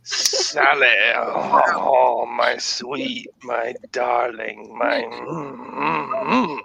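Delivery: hammy romantic French lover; Pepe Le Pew. Pretend. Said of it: "now I'm on Pepe Le Pew".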